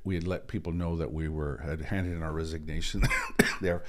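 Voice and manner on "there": coughs breaking up the words; coughing from allergies; coughing fit. Real, not acted. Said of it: "I apologize for my coughing".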